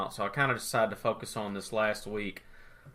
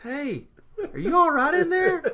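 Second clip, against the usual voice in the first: very high, timid mock voice; imitating tentative friend; 5 dB louder than usual. Friend inching into the bathroom to check, mock tentative. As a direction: check in gingerly, pitched way up. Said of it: "You, like, inched into the bathroom".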